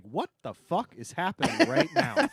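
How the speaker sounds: confused surprise. Retold or imitated. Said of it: "just be like".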